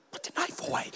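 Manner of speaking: unvoiced whisper to the son. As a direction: hiss it so others won't hear.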